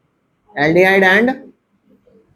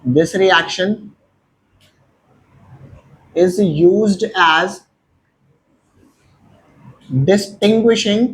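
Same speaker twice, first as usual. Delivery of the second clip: dictation pace; much slower than usual. Shown as a demonstration. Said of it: "क्या लिखेंगे?".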